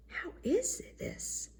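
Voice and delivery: slow, high, alarmed whisper; whispered to himself. A narrator's reading. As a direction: whisper it in sudden private dismay. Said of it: "said the emperor to himself".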